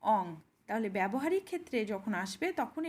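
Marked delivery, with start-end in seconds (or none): 0.0-0.2 অং as a short nasal ong; teacher showing the sound in রং. Shown as a demonstration. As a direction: end অং in a humming ng. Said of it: "এর এখানে sound হচ্ছে".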